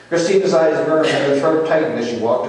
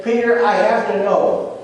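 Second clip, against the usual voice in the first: high, urgent demand; rising voice; 7 semitones higher than usual. Retold or imitated. Jesus needing Peter's answer, high and insistent. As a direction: press for an answer, pitch raised. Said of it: "his voice rose each time he asked him".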